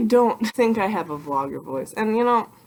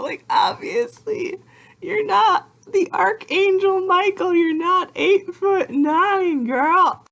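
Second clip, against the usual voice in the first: much higher, sarcastic squeaky scold; mocking high voice; 14 semitones higher, 3 dB louder, slower than usual. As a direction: scold yourself in a shrill mocking voice. Mocking herself again, high voice.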